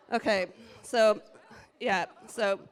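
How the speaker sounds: ordinary delivery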